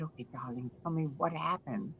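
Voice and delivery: soft, gentle. Retold or imitated. Yvonne gently asking the young man his news, soft and tender. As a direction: coax it out gently like a kind mother. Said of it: "And she said".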